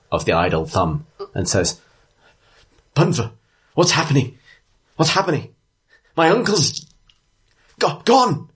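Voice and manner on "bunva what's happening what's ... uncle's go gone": high-pitched, halting worried plea; distressed townswoman. Acted. Dwarf bartender asking the leader what's happening, distressed. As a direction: plead for answers, climbing higher and breaking the words. Frightened bartender pleads for news.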